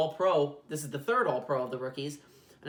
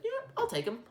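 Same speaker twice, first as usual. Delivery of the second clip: squeaky high mock coach, much higher; a quick hammed-up coach voice, pitched way up; John Gruden impression. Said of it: "John Gruden would be like".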